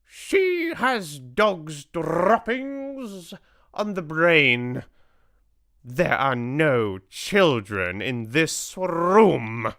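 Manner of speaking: loud, slow, high imperious voice; Grand High Witch. A narrator's reading. Grand High Witch scoffs at the assembly, slow shouted scorn. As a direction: declare it slowly, loudly, stretching the words. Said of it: "shouted the Grand High Witch".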